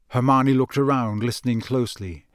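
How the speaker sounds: usual speaking voice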